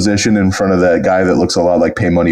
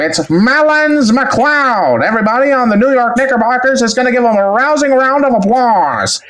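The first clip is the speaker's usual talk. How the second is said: put-on old-timey announcer; 14 semitones higher than usual. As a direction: announce the batter like the 1920s. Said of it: "really good old-timey announcer voice".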